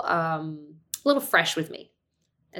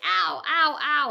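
high mock yelps of pain; kid's ow; 8 semitones higher, slower than usual. Son yelping as dad tickles too rough, high mock ows. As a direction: yelp like a tickled boy.

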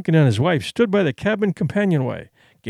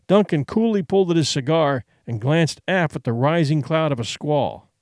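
ordinary delivery

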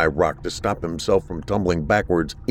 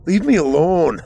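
higher, louder desperate cry; 9 semitones higher, 5 dB louder than usual. A narrator's reading. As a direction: push him away with your voice. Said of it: "Arthur cried out".

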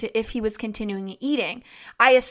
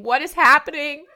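higher, louder desperate cry; urgent shout; 5 semitones higher, 6 dB louder than usual. Retold or imitated. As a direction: call out in panic. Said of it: "I just kept saying".

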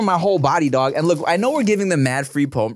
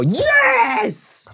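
sudden high shout of joy; excited shout. Real, not acted. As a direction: yell it with delight. Excited about getting hot mustard.

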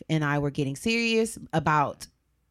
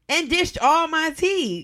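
high, slow and stretched out; indignant; 8 semitones higher, 3 dB louder, slower than usual. Real, not acted. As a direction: stretch it out in disbelief. Outraged telling of betrayal.

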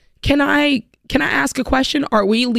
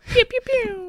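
high, soft pew pew laser zaps; laser sound effects; 14 semitones higher, 4 dB quieter, slower than usual. Shown as a demonstration. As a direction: zap it like a toy ray gun. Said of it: "I do my own sound effects".

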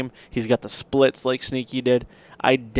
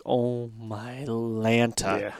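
drawn-out disbelief; 8 dB quieter, much slower than usual. Retold or imitated. Stunned at passing big buck.

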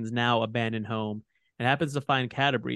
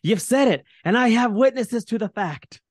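loud gloating, much higher; triumphant shout; 11 semitones higher, 6 dB louder than usual. A narrator's reading. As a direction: crow it loudly at them. Bruner gloating loudly.

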